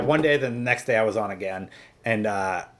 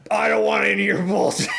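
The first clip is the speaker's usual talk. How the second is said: angry boss yelling; 8 semitones higher than usual. Retold or imitated. Boss yelling at the teen who quit, angry shout. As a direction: bark it like an enraged manager. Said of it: "he lost it on me".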